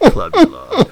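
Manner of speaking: high, loud mock slogan; hyped-up ad voice; excited tagline. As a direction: shout the slogan like an ad. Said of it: "a better tagline".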